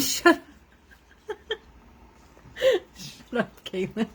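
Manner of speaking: real laughter through the words; laughing. Real, not acted. Laughing back at a viewer who teased her. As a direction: laugh it off while saying it.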